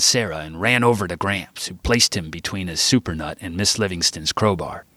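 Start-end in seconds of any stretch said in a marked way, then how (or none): none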